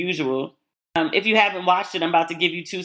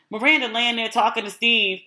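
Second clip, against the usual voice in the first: ordinary delivery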